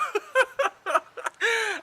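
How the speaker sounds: high, loud amused laughter; real laugh. Real, not acted. Storyteller plans to sell friend's TV, laughing. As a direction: crack up at your own cheeky plan. Laughs at own hustler plan.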